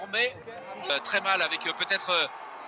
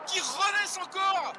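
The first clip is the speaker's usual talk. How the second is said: excited shout; 10 semitones higher than usual. Real, not acted. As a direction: yell the interception try. Interception try called live. (cri enthousiaste de commentateur)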